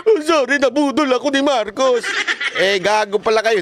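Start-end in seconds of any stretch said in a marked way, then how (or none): 0.1-2.0 high, mock-sheepish whiny apology with a laugh. Mocking former critics who now admit being fooled, high whiny apology. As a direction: ham up a fooled supporter begging forgiveness. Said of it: "Tapos ngayon".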